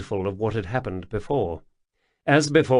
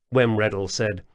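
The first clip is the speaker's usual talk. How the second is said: ordinary delivery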